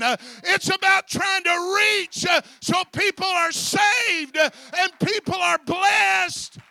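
high, very loud pulpit shout; full-force preaching. Real, not acted. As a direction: drive each sentence home at a shout.

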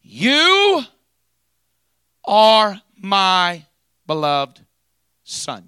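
very high, loud booming proclamation fading to plain; voice from heaven. Shown as a demonstration. Preacher showing the congregation how heaven speaks, booming. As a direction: start up high and booming, let it settle on Son. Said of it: "says it just like this".

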